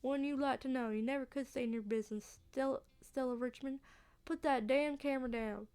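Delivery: testy Southern country voice; southern accent. Acted. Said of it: "a southern accent".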